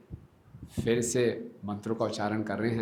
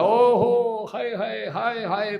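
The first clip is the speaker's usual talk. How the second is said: much higher, louder cry of hai hai; 11 semitones higher, 9 dB louder, much slower than usual. Acted. Brahmin again finds Nimai eating the offering, loud cry. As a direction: cry out in shock. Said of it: "वो फिर बड़ी जोर से बोला".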